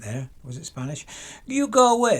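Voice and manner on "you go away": high, loud mock Borat-style accent; lightly hammed-up foreign accent shooing someone. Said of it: "It sounded like Borat, didn't it?".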